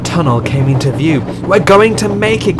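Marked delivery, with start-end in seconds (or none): 1.5-2.6 high, excited cry of hope; elated, pitched well up. A narrator's reading. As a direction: cry out as safety comes in sight. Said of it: "cried Montague".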